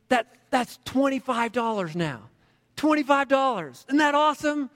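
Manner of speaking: proud of his savings; little boy excitedly counting money for his dad. A narrator's reading.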